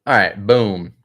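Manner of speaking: talking while chewing gum; words round a wad of gum. Real, not acted. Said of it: "sorry for the fucking chewing".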